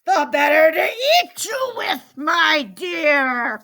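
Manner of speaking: loud, rising menacing roar; wolf's cry. A narrator's reading. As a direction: pounce on it as a hungry wolf, full voice. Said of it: "cried the wolf".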